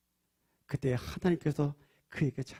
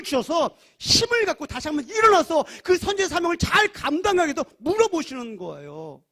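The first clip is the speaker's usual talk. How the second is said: higher, louder urgent sermon; impassioned preaching; 11 semitones higher, 10 dB louder than usual. Acted. Preacher urging the congregation, loud and impassioned. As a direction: drive the point home with fervor.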